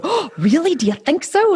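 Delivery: high, delighted "oh really?" in a squeal; mock surprised. Mother answering her daughter's comment, high mock surprise. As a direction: play up the surprise at a child's compliment. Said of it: "And I'll go".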